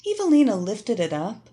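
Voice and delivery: ordinary delivery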